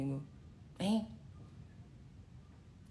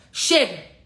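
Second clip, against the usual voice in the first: battle cry shout; 2 semitones higher, 9 dB louder, much slower than usual. Shown as a demonstration. Coach urging viewers to say enough, a loud battle cry. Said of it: "Dá um grito de guerra aí".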